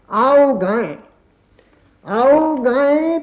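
measured, lilting verse recital with drawn-out line ends; poem recitation. Acted. Poet presenting new songs to listeners, lilting poem recitation. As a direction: recite verse, repeating lines with swelling pitch.

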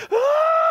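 high, loud anguished cry; a kid's howl of despair; left-behind boy howling; childish wail. Retold or imitated. Left in parking lot, breaks down.

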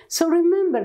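ordinary talk

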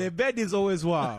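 loud, high, hammed-up Nigerian accent. Repeating the family joke to co-hosts, loud Nigerian accent. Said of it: "that is a Nigerian accent".

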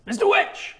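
high, loud call across the crowd; shouting a name. Acted. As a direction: call out over the fair. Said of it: "And he shouts over".

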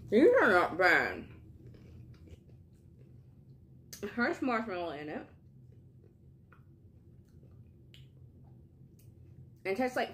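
eating a sweet snack; talking while chewing. Real, not acted. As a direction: mouth partly full of food. Seen 3: Bites at -4, holds treat, lips pursed as if chewing while talking.

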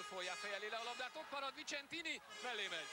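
excited hungarian sports commentary. Real, not acted. Live call of a near goal.

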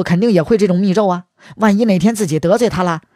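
ordinary delivery, nothing marked

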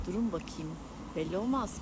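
usual speaking voice

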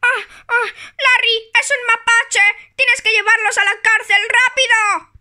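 high, loud frantic cry for help; panicked shouting. Pretend. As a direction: beg Larry to lock the raccoon up, fast. Toy panics about raccoon.

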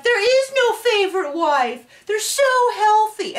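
high, gushing fake-cheerful voice; mocking gushing students. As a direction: parody naive students raving. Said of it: "they're like".

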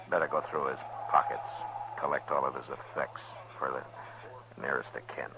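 hushed whisper over the body. Acted. Marshal telling Chester to collect the dead man's effects, whispered. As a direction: drop to a whisper, respectful of the dead. Quiet task beside a body.

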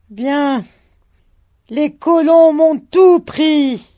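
high, loud, slow bitter cry; old man cries out against the colonists; bitter shouting. A narrator's reading. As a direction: spit out the word colons.

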